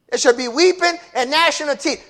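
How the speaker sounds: fiery preacher, high and loud; preaching at full force. Acted. Preacher warning the church about hell, fiery and loud. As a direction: thunder the sermon at the congregation.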